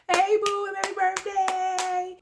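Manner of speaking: high, bright sing-song birthday wish, slowing on the end; excited birthday greeting. Real, not acted. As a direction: call out happy birthday to your friend. Birthday shout-out to Melissa.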